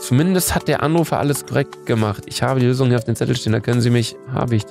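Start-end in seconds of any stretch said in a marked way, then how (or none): none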